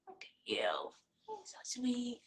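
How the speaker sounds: slow, soft murmur to a puppy; soft cooing. Real, not acted. Admiring puppy Nita up close, soft slow coo. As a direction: croon it gently to the pup.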